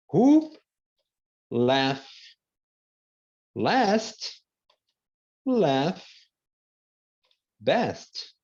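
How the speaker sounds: each word of "who laugh last, laugh best" set apart with long pauses; slow, word by word. Shown as a demonstration. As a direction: dictate it word by word. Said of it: "vou até colocar aqui pra você". (ditado palavra por palavra, pausado)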